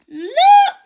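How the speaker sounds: sudden high squawking shout. Acted. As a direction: burst out with a shrill bird cry. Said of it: "squawked Basil suddenly".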